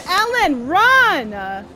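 loud, high panicked shout, stretched out; frantic yelling. Real, not acted. Gamer screaming at Alan to run, frantic high yell. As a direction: scream at him to hurry up.

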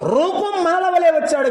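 high, ringing preacher's cry; preaching at full force. Acted. A preacher lifting his voice to the congregation. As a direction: proclaim it to the whole hall.

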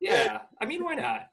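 put-on high, airy transatlantic accent, old Hollywood; a glamorous old Hollywood star's transatlantic accent. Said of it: "You have to also speak with a transatlantic accent".

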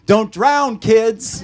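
high, loud sing-song call out the window; a cheerful fifties mom hollering to the kids; cheerful June Cleaver mom. Retold or imitated. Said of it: "This big, cheerful June Cleaver".

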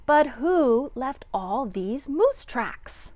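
lively picture book read-aloud with a sing-song lilt; reading to children. A narrator's reading. Kindergarten teacher reading a picture book to her class, lively. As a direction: read it like story time for kindergarteners.

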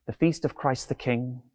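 usual speaking voice

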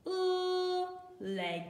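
"Oo" sung high and long, then "leg"; oo sound. Shown as a demonstration. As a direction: stretch "Oo" up high before "leg". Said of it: "writing oo".

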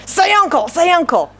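high-pitched mock taunt; bullying brother. Brother pinning his brother down, high mock demand. Other brother now on top.

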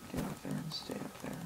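soft unvoiced whisper; whispered coaxing at the screen. Real, not acted. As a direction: urge him on under your breath. Urging mob under breath.

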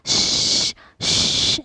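unvoiced hissing like a snake; imitating the snake's hiss for friends. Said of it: "ऐसे देखो".

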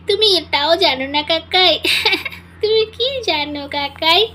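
put-on high child voice, cheeky and giggly; a small girl mocking her silly uncle; little girl teasing. Rimi teasing her uncle, in a high cheeky child voice.

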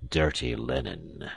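low, slow rumble; rumbled. A narrator's reading. Husband cutting into his wife's tirade, low slow rumble. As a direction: rumble it slowly, deep down. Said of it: "he rumbled".

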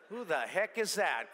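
usual speaking voice

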